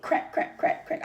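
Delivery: whispered "crack, crack" like breaking; heart cracking sound. Retold or imitated. Describing heartbreak, whispered cracking sound effect. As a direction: mimic a heart breaking, breathy clicks. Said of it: "I be feeling my heart just break".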